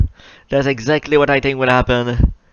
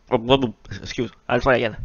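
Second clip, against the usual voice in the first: normal talking voice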